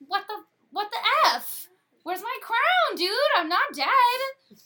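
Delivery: high, loud outraged yell, drawn out at the end; outraged shout. Acted. The king wakes up and finds his crown taken, outraged shout. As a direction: bellow it like a king who wakes to find his crown missing.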